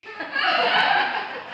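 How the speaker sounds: mocking laugh.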